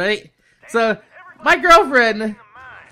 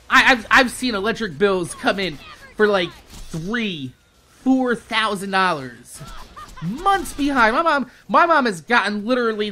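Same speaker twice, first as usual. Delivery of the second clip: ordinary talk, nothing marked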